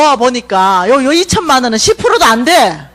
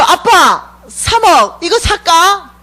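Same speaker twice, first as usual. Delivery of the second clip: higher, slow coaxing ask; childlike plea to Father; 6 semitones higher, much slower than usual. Retold or imitated. A daughter coaxing her heavenly Father, wheedling and slow. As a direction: wheedle like a child asking daddy. Preacher quotes her prayer to God.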